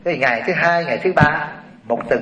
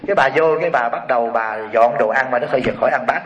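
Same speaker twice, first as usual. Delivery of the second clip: ordinary talk, nothing marked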